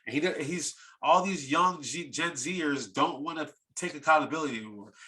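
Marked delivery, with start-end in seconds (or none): none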